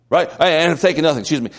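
usual speaking voice

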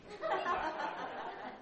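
high, breathy burst of laughter; real amused laugh. Real, not acted. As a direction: laugh at your own joke. Laughs at own retold line.

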